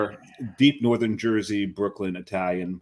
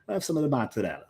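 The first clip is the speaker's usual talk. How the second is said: higher, lightly voiced Italian accent; polite Italian customer's request; real Italian speaker; 5 semitones higher, 4 dB quieter, much faster than usual. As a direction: order with proper Italian vowels. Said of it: "the real Italians going".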